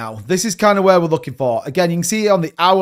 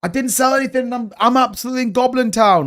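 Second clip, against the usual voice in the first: higher, sulky complaining viewer; whiny viewer; 7 semitones higher than usual. Retold or imitated. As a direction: play the sorry bagholder moaning. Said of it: "you're like".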